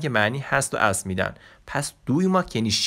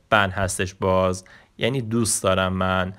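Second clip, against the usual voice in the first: ordinary delivery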